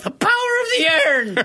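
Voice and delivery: high squeaky cat man; weird cat man voice. Retold or imitated. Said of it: "in his weird cat man".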